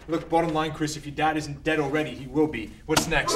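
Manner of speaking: ordinary delivery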